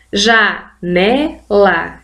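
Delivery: janela split into slow syllables, ja-ne-la. Shown as a demonstration. Teacher sounding out a word for her students, slow syllables. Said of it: "três vezes a gente abre a boca pra falar".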